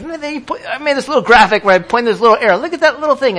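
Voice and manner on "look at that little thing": lightly raised cooing tone; a hint of cooing parent. Pastor showing his baby graphic, a light coo. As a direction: coo lightly at the little picture.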